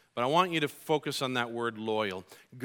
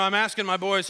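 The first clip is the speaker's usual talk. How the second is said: ordinary talk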